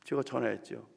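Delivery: ordinary talk, nothing marked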